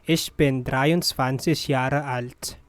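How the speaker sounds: usual speaking voice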